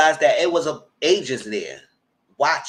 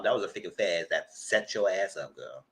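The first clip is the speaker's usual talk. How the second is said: usual speaking voice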